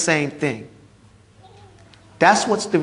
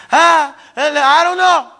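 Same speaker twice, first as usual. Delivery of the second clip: high, slow, lost "ah, I don't know"; a terrified soul who has no answer; confused mumble; 7 semitones higher, 4 dB louder, slower than usual. Acted. Dead man answering the angels, high, slow and lost. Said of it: "It says".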